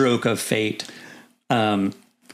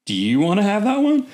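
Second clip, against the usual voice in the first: much higher hopeful voice, leaning in; eager offer; 11 semitones higher, 4 dB louder than usual. Retold or imitated. Officer offering his friend the posting, eager and high. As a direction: pitch it up like you're dangling a prize. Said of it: "I was like".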